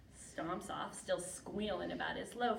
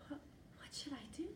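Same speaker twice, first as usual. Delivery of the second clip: quick, soft, higher stammer; hushed, fluttery question; nervous whisper; 5 semitones higher, 8 dB quieter, faster than usual. Acted. Smitten Martina asks her grandmother.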